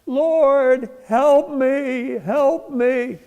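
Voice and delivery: high, loud cry for help from the cliff; frantic plea shouted skyward; desperate shout. Acted. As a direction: yell it up to heaven, hanging on for dear life. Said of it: "So he shouts out".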